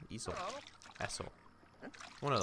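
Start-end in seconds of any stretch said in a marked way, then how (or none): 0.1-0.3 "Easel" said ee-zul; trying a pronunciation. Shown as a demonstration. Player settling on the word, "Easel" said ee-zul. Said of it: "Is that how you say it?". 1.0-1.2 "Easel" said with an opening eh; trying another pronunciation. Shown as a demonstration. Player still testing the word, "Easel" said eh-sul. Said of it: "Is that how you say it?".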